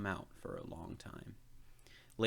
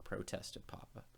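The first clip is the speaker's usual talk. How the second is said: ordinary delivery, nothing marked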